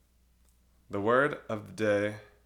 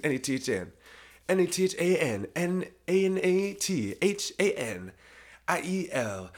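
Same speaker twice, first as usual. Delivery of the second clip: spelled out slowly; 5 semitones higher, slower than usual. Shown as a demonstration. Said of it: "That's my name".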